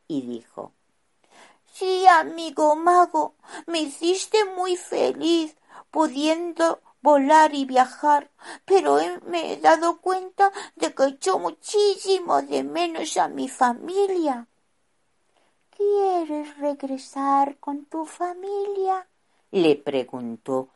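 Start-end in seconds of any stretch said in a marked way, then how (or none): 1.7-14.5 put-on higher-pitched little boy, homesick; a small boy missing his family; high child voice. Rufino's voice in story. 15.7-19.1 put-on young wizard voice. Wizard asks slowly.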